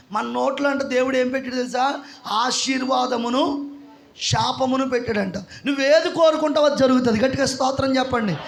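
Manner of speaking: ordinary delivery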